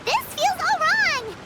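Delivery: whiny slow protest; uneasy protest. Acted. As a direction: drag it out, unconvinced. Paimon protesting the plan.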